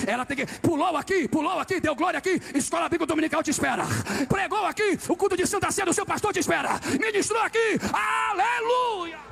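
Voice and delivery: high, driving preacher's shout; full-force preaching. Real, not acted. Preacher rousing the church over who waits for them, shouting. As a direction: build to a long, held hallelujah cry.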